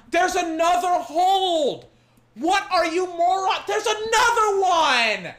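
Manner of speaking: can't believe they missed it again; outraged yell. Real, not acted. Fan spotting another missed hold, outraged yell.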